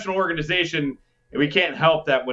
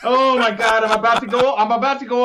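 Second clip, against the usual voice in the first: laughing exasperation; 5 semitones higher, 3 dB louder than usual. Real, not acted. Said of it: "Arizona type rant".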